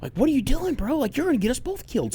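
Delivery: high, fast alarmed hushing; frantic, trying to shut the kid up; mock panic. Dad hushing his daughter before mom hears, high and panicked.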